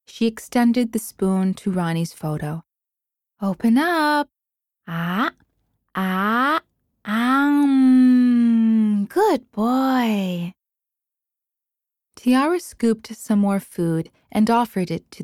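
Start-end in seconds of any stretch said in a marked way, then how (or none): 3.4-10.5 coaxing mother. Acted. Mother spoon-feeding son's photo.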